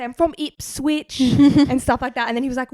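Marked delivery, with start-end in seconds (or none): 0.0-1.2 put-on slow, higher British accent; put-on British accent, performed slowly; fake British accent. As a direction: draw it out in a hammed-up British voice. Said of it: "my Oscar-worthy performance".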